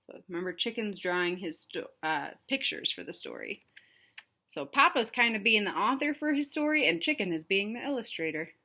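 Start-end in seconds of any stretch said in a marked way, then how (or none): none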